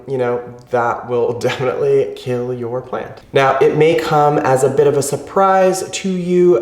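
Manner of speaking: usual speaking voice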